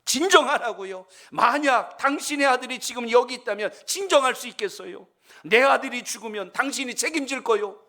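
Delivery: father shouting blame at the calm doctor; angry shout. Retold or imitated. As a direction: throw the doctor's words back at him, furious. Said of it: "더 큰 소리를 지르며 원망을".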